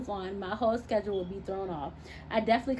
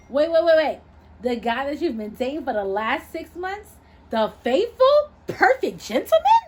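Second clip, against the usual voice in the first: much higher and louder, laughing disbelief; can't believe it, loving it; amused disbelief; 5 semitones higher, 9 dB louder than usual. Acted. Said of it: "I asked in disbelief with humor in my voice".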